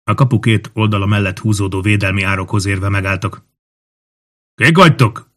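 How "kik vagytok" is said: high, loud call from above; shouted challenge. A narrator's reading. Guard in the gate tower challenging strangers, shouting. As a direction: demand it in a raised shout. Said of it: "kiáltotta egy hang a kaputoronyból".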